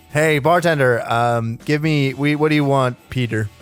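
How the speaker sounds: normal talking voice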